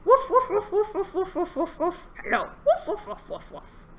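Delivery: high, rapid-fire woofs; dog-like barking. Pretend. Showing children a dog-like hello, high yapping. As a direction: yap like an excited little dog. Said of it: "Dog-like as we can".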